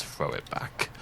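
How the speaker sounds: soft unvoiced whisper; eerie whisper. Narrating the book scene, the command whispered eerily. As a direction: breathe the words out like a ghost.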